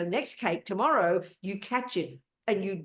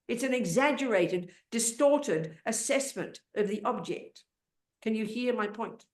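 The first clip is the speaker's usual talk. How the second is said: ordinary talk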